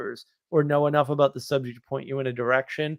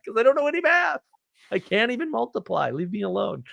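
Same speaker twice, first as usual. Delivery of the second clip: much higher, whiny protest; whiny self-defense; 9 semitones higher than usual. Retold or imitated. Speaker answering his annoyed buddy about the math, high whiny protest. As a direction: wail it out like a helpless kid. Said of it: "answering the buddy".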